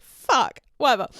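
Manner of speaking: loud, acted curse; dismayed swearing. Shrugging off her date's new girl, loud dismayed curse. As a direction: curse loudly, then brush it off.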